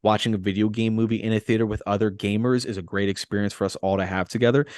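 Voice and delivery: ordinary delivery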